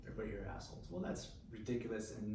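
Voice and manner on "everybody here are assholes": bitter whisper. Retold or imitated. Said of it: "Very justifiable at the time".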